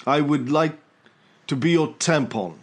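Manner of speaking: halting royal English accent, mock-earnest; a hammed Charles-style royal accent, with pauses; Prince Charles accent. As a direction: put on Charles's hesitant upper-class English. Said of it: "doing my Prince Charles accent".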